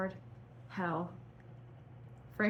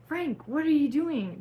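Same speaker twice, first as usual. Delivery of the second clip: high, loud cry of alarm; alarmed yell, pitched high; read-aloud yell; 7 semitones higher, 8 dB louder than usual. Acted. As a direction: shout it up high and loud at him. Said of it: "Gerard yelled".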